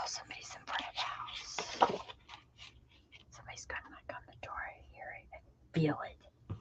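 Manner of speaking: barely audible, secretive whisper; hushed. Real, not acted. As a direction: whisper it as if someone outside might hear. Hushed after hearing a door slam.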